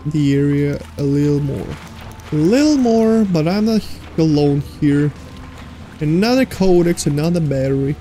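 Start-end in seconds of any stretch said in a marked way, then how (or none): none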